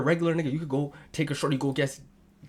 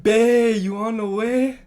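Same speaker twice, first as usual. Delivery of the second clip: high, loud, drawn-out mock plea; mock eager call; 7 semitones higher, 8 dB louder, much slower than usual. Reading out a man's text to mock him, mock eager call. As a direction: call it out high, stretching the end. Mocking a text read aloud.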